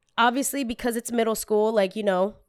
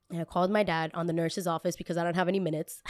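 ordinary talk, nothing marked

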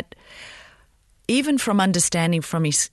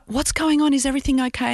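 voicing puzzled parents, pitched up; worried parents; 10 semitones higher, faster than usual. Parents asking at the party.

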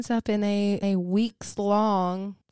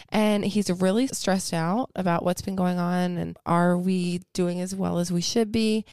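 ordinary talk, nothing marked

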